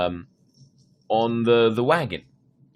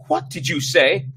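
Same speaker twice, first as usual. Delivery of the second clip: higher, louder outraged question; 8 semitones higher, 7 dB louder than usual. Acted. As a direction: demand it with mounting outrage. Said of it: "her voice tight with growing outrage".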